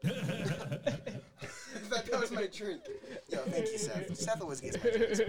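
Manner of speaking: high, loud laughing speech; words bursting through laughter. Real, not acted. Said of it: "I gotta master that laugh".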